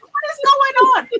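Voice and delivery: shocked squeak. Retold or imitated. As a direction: squeak out the question in disbelief. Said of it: "I was like".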